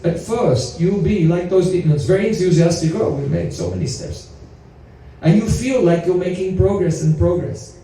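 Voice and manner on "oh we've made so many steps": mock enthusiasm. Mocking the enthusiastic demons.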